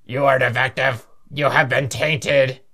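robot voice. Acted. Plays hostile robot in game.

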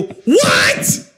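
high, strained mock cry; pained disbelief. Patient to doctor asking how it feels, pained disbelief.

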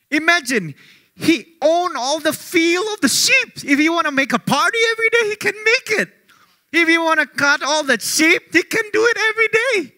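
high, loud, driving preacher's cry; impassioned preaching. Real, not acted. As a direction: press it on the congregation, rising. Preacher building up the point.

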